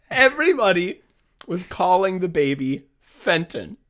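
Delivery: measured retake, drawing out the name; composed re-read. Real, not acted. Rereads text after cracking up.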